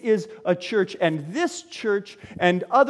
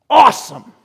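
excited; 5 semitones higher, 12 dB louder than usual. Retold or imitated. Peter voiced, thrilled.

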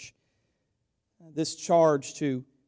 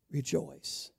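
much softer, near hushed; soft rejoice; 8 dB quieter than usual. Acted. Pastor gently urging the church, softly. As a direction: let it fall soft.

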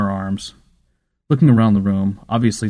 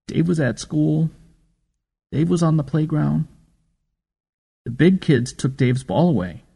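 lightly voiced child; 6 semitones higher than usual. A narrator's reading. Boy tells grandmother about bullies, a hint of a child's voice. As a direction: narrator lightly voices the upset little boy. Child voice telling bully story.

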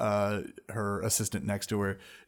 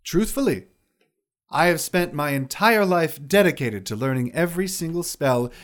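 high wizard lady voice; 6 semitones higher, 4 dB louder than usual. Acted. GM voicing wizard.

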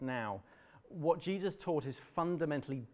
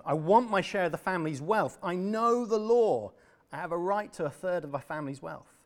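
rising demand; 5 semitones higher, 6 dB louder than usual. Acted.

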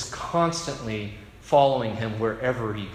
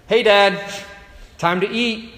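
higher, louder mock Esau greeting; Jacob faking Esau; 7 semitones higher, 6 dB louder than usual. Jacob greets his blind father, faking Esau's voice. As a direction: put on a bright fake voice to fool Dad.

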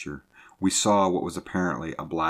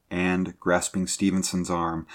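plain reading voice

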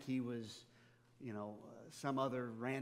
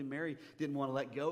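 ordinary delivery

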